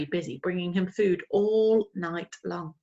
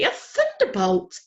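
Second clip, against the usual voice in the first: high, slow, startled exclaim; startled cry; 12 semitones higher, 4 dB louder than usual. A narrator's reading. Old lady crying out as Plop lands at her feet, high. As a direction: gasp it out in alarm. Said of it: "cried the old lady".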